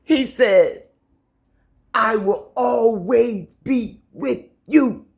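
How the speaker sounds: high, loud fervent sermon voice; preaching. Real, not acted. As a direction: preach the scripture with fire. Preaching, slow.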